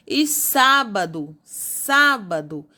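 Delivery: SÁBADO drawn out slowly on its first syllable, SÁ; stressed first syllable. Shown as a demonstration. Teacher showing pupils the tonic syllable, stress on SÁ in "sábado". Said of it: "a antepenúltima sílaba é tônica".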